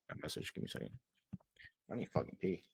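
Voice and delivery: softly whispered, turned away from the mic; hushed aside to someone nearby. Real, not acted. Speaker stepping away from the recording, whispered aside.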